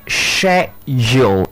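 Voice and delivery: "schedule" said slowly as shej-ul. Shown as a demonstration. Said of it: "that word is".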